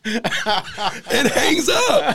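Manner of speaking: can barely get the words out for laughing; laughing hard. Real, not acted. Both laughing at the punchline.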